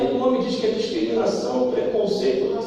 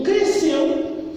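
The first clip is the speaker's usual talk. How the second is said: ordinary talk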